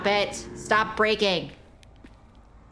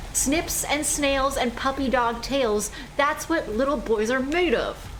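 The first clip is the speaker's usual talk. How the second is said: slow, lilting nursery rhyme; sweet nursery rhyme, a little mocking; singsong rhyme recital; 4 semitones higher than usual. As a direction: recite it like a playground chant.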